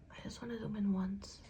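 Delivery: soft unvoiced whisper. Real, not acted. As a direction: hush it while zooming in. Whispering while zooming camera.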